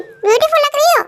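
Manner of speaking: high, hammed-up, insincere praise; mock compliment. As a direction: toss off a fake compliment, pitched high. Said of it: "झूठी तारीफ की ना". (ऊँची आवाज़ में झूठी, दिखावटी तारीफ़)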